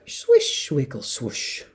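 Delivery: ordinary delivery, nothing marked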